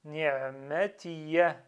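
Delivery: ni'matiya slowly, ending in a sounded "ya"; pronounced final ya. Shown as a demonstration. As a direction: finish ni'matiya on a short, clear "ya". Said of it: "il doit être prononcé".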